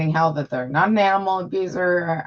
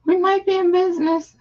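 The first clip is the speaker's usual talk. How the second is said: excited relief; 13 semitones higher, 4 dB louder than usual. Real, not acted. Stream finally loading.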